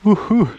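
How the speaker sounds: a high, loud, happy whoop at the summit; joyful whoop. Real, not acted. Hiker reaching the top without the big pack, loud happy whoop. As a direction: let out a real cheer of relief.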